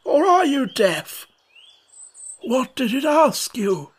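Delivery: put-on a cranky old frog losing patience; grumpy old frog. A narrator's reading. Old frog asking Alice if she's deaf, grumpy.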